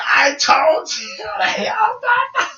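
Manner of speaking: high, slow mock wail; wailing mother. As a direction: scold in a drawn-out, tearful cry. Mother crying out in retold scolding.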